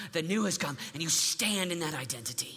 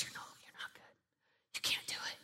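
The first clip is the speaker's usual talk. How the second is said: very soft unvoiced whisper; enemy whispering; 14 dB quieter, faster than usual. Devil whispering lies to a teen, soft and unvoiced. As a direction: hiss out the doubt. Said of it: "the lie of the enemy whispers".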